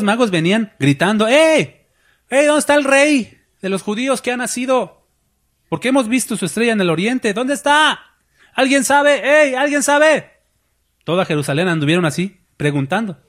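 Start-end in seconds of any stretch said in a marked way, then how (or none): 1.3-10.3 high-pitched calling, asking around the city; magi calling out. Acted. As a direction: shout up high like travelers searching for a newborn king.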